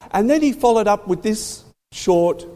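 ordinary talk

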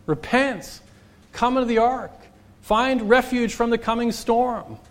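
high, urgent preacher's call; Noah preaching. Acted. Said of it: "he's preaching every day".

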